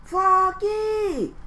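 high loud call; mother calling. Acted. Mother calls to Froggy while aiming a camera, loud. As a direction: shout his name from the crowd. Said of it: "called his mother".

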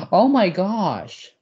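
slow, loud, high gasp; shocked gasp. Real, not acted. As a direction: gasp and drag it out.

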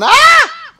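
a sudden high piercing scream; real scream of fright. Real, not acted. Player caught by the monster, shrieking in terror. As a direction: shriek as the monster grabs you.

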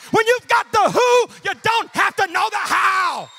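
loud, high, driving sermon; fiery proclamation building in pitch and force; full-force preaching. Real, not acted. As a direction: preach up to a stretched, shouted call.

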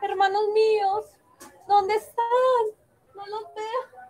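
high, tearful call for lost brothers. Acted. Princess calling her lost brothers, tearful. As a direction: cry out for them, near tears.

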